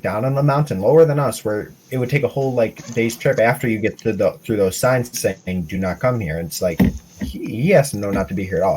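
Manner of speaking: ordinary talk, nothing marked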